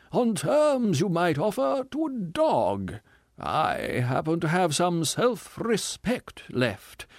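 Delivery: high, slow, theatrical self-pitying voice; a wounded ham actor milking every line; tragedian. Acted.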